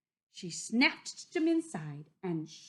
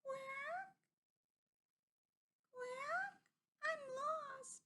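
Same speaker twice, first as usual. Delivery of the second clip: tiny, very high, soft lost duckling; squeaky little forlorn quack; small sad voice; 13 dB quieter, much slower than usual. A narrator's reading. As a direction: peep it sadly, small and quiet. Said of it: "sad little voice".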